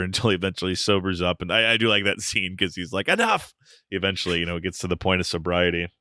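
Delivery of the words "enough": a sharp, much higher shout of Enough; sudden shout. Retold or imitated. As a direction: burst out to end it all. Inigo snapping out of drunkenness.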